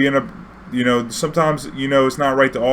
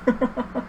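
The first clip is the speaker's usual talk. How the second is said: short high laugh; 12 semitones higher, 5 dB quieter than usual. Real, not acted. Laughing again at the line.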